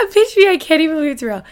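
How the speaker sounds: high, loud, fast burst of real excitement; too excited. Real, not acted. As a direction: blurt it out in giddy disbelief. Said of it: "I need to not get too excited".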